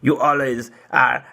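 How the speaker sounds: a lightly put-on voice for the ex; imitating ex-girlfriend. He mimics his ex-girlfriend ending the friendship, lightly put-on voice. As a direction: mimic her brushing him off, half-mocking. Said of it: "she was like".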